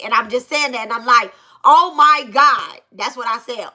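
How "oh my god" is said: slow, loud, higher retold shock. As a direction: stretch out each word in stunned disbelief. Retold shock at near shooting.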